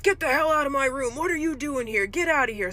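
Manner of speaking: imitating a resident shouting at an intruder; imitated angry yell. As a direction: yell him out of the room, hammed-up. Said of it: "starts yelling".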